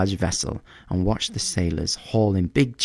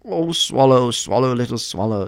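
lightly voiced prince, higher, drawn to the bird; pleading prince; 5 semitones higher, 3 dB louder than usual. A narrator's reading. Prince calls to the little swallow, gently pleading.

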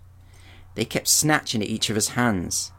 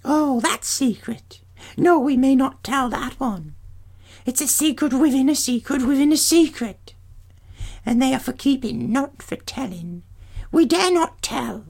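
very high crone voices, secretive and frightened; fearful old hags; 14 semitones higher than usual. Acted. Grey sisters refuse Perseus in high fearful hag voices. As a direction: ancient hags too scared to tell.

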